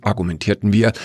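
ordinary delivery

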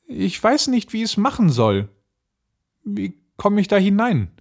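put-on high-pitched little girl feigning helplessness; Gretel. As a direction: play clueless in a high girlish voice. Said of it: "Gretel merkte, was sie im Sinn hatte, und sprach". (ahnungslos tuendes Mädchen)

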